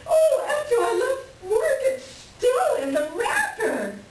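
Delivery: squealing high with laughter, almost crying; bubbling over with giddy joy; shrieking with delight at a new record; thrilled. Acted.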